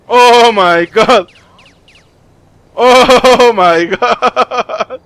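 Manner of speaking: long, loud, drawn-out amazement; astonished exclamation. Real, not acted. Player reacting to shocking game event, drawn-out astonishment. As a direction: stretch it out slowly and loudly, breaking into laughter.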